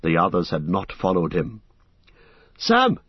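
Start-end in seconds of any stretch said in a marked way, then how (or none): 2.6-3.0 loud high call across the dark; a sudden loud call into the mist. Acted. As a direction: shout for a friend who has fallen behind. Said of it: "he called".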